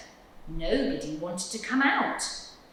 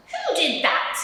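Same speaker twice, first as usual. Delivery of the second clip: high, louder cross squirrel; 11 semitones higher, 5 dB louder than usual. Acted. Squirrel fallen out of bed, crossly demanding. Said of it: "asked the squirrel crossly".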